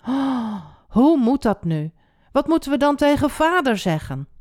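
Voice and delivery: gasp, then ask in rising worry; alarmed. A narrator's reading. Ruben frets about telling father, alarmed. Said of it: "Ruben schrok".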